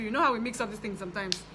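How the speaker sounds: ordinary talk, nothing marked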